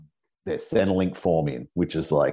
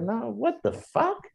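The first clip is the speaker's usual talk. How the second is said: feigned innocence; slower than usual. Retold or imitated. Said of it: "I was like".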